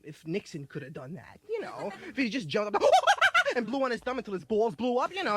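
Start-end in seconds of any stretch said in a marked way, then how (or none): 2.9-3.5 high, loud blowing squeal; monkey puff noise. Retold or imitated. Imagining Nixon puffing like a monkey with a high squeal. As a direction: blow up like a threatened monkey.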